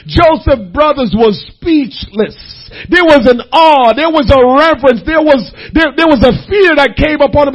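loud, ringing sermon build; full-force preaching. Acted. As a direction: preach it out to the congregation.

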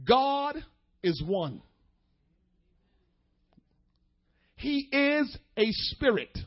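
slow declaration with long pauses; slow, deliberate. Real, not acted. Preacher spelling out the foundation for the congregation, slowly. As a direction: lay out each phrase separately so it can be heard. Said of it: "I'm gonna say it slowly so you can hear it".

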